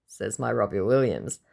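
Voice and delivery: ordinary talk, nothing marked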